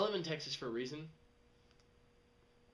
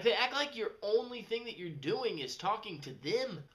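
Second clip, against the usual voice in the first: normal talking voice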